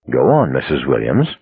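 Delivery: low, mocking urging; soft, tense mockery. A narrator's reading. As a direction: urge her on with quiet mockery. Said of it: "with soft, tense mockery".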